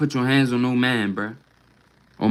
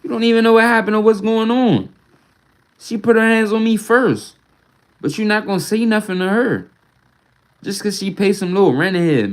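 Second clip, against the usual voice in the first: raised, higher, heated complaint; angry outburst; 7 semitones higher than usual. Real, not acted. Venting at unfair treatment.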